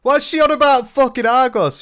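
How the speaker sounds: loud, high-pitched disbelief; exasperated outburst. Real, not acted. Streamer baffled by cameo's talk of Argos, exasperated. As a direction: burst out in baffled annoyance.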